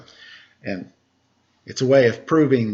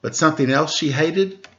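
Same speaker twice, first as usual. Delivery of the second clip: put-on bright, higher read-aloud voice for children; storybook narration; 4 semitones higher, slower than usual. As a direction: read it like a picture book to kids. Reading a picture book aloud.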